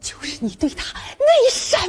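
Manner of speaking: slow, hushed innuendo rising high; suggestive teasing. Acted. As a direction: draw out the insinuation, half under your breath. Colleague hints at scandal. (暧昧拖长试探)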